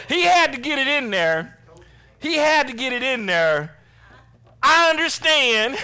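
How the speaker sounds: loud, high comic grumbling; mock exasperation. Preacher grumbling about Paul's I-told-you-so, mock exasperation. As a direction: complain it for laughs. Voices annoyed men Paul scolds.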